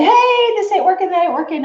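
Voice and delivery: high-pitched mock heads-up; imitating helpful viewers. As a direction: call it out high, like a viewer alerting the host. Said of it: "some of you are so sweet to quick, like, say".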